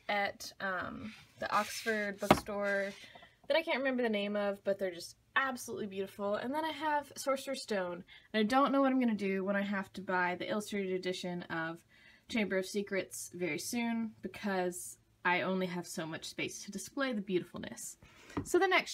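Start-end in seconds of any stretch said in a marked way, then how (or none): none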